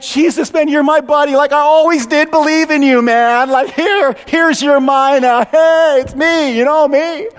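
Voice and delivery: high, eager suck-up voice, pitched well up; butter up the returning king like an old pal; fawning buddy. Acted. Servant fawning over returning Jesus.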